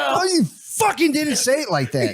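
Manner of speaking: high-pitched outraged retort; exasperated yell. Acted. Boyfriend disputing her innocent replay, exasperated and high. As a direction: burst out in mock exasperation. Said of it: "what happens is you yell".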